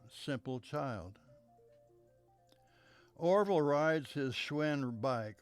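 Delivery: ordinary delivery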